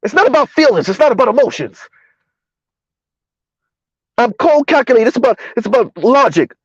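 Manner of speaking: mildly mock her insistent fake logic; mocking Princella's delivery. Commentator imitating a woman who claims cold logic, lightly mocking. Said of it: "With her fake logic".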